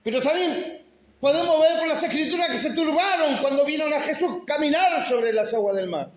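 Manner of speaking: high, loud preaching building to a shout; fervent preaching. Acted. Pastor reminding believers of the miracles, fervent preaching. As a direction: preach with mounting fervor.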